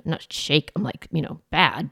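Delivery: ordinary talk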